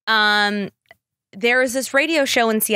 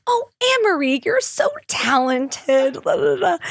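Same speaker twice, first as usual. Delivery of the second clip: much higher, gushing mock mom voice; proud mom; 8 semitones higher than usual. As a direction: gush like a proud mother, pitched way up. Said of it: "and she goes".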